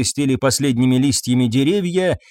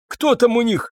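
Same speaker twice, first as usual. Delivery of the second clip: plain reading voice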